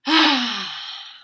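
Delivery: loud, long contented sigh; big breathy exhale of delight; happy sigh. A narrator's reading. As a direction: sigh blissfully as the happy pigeon. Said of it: "Pigeon sighs again".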